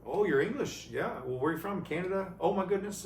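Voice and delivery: high-pitched sing-out of friendly surprise; imitated friendly women. Voicing friendly women who helped.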